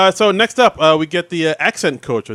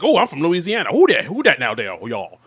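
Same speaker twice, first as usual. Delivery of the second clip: exaggerated Southern accent. Podcaster acting out the coach's line read, exaggerated Southern accent. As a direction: imitate a hammy fake Southerner from Louisiana. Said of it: "it's him doing his exaggerated Southern accent".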